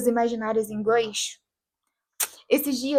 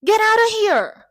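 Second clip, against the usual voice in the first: high, drawn-out imitated shout; shadowing the character; 9 semitones higher, 6 dB louder, slower than usual. Speaking along with the scene's character, drawn-out mimicked shout. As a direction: echo the TV outburst, loud and slower. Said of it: "Eu falava junto".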